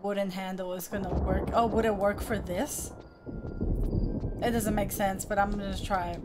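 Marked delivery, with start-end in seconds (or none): none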